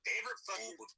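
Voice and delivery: soft, animated New York impression; hammed-up New York accent; thick New York accent. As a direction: talk like a die-hard New York local. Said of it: "Let's hear that thick New York accent".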